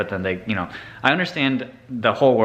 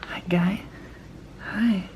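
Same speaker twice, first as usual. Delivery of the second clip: high, soft, half-whispered hello; gentle pet greeting; 9 semitones higher, 7 dB quieter, slower than usual. Real, not acted. Vlogger approaching a shy cat, soft high greeting. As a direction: coo it quietly so the animal stays put.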